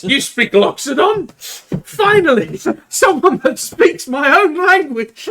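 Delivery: high, loud delighted squeal; overjoyed. Acted. Elephant player thrilled to meet a fellow speaker, high delighted cry. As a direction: burst out with joy at hearing your tongue.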